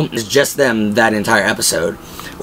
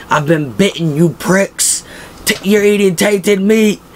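high, strained mock-hysterical cackling taunt; 8 semitones higher, slower than usual. Bob taunting his cannibal captors, hysterically high and mocking. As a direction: jeer at them like a man gone half mad. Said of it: "he starts laughing just hysterically".

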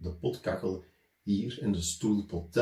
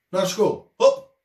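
loud, higher command; teacher shouting; 8 semitones higher, 10 dB louder, slower than usual. A narrator's reading. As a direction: chase the kids off with a shout. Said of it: "riep hij". (de meester jaagt de kinderen weg, luid roepend)

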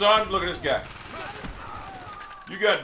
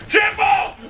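high, excited cry; triumphant shout; 8 semitones higher than usual. Real, not acted. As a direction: crow over the triple kill. Celebrating a triple kill.

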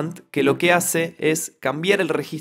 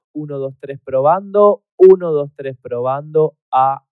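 modulator vocoder; 6 dB louder than usual. Shown as a demonstration. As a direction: voice modulating itself, messy and louder. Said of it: "Fíjense".

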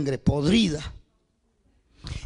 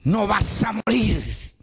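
proclaiming promise; 4 semitones higher than usual. Acted. Preaching divine promise.

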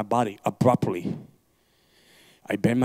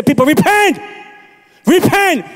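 shouted call to repent; 11 dB louder than usual. Retold or imitated. Preacher voicing pastors calling repentance.

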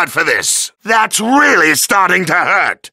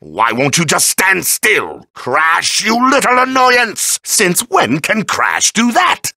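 ordinary talk

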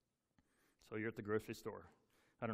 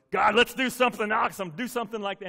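loud, pleading prayer, pitch up; begging God for something big; eager loud prayer; 5 semitones higher, 10 dB louder, faster than usual. Retold or imitated. Pastor retelling his prayer to God, loud and eager. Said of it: "You know".

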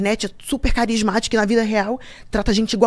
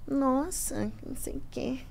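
softer, mumbled complaint; muttered grumble; 17 dB quieter, slower than usual. Retold or imitated. The woman walking off, grumbling quietly. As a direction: grumble it under the breath.

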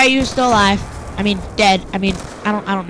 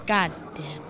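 usual speaking voice

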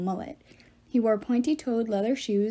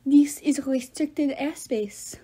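put-on very slow, higher French accent; fake French accent; 4 semitones higher, much slower than usual. A narrator's reading. Love god warns intruders off his airspace, fake French accent. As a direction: stretch it out in a bad French accent. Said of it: "he had a French accent so bad".

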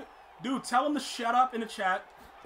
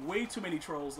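ordinary talk, nothing marked

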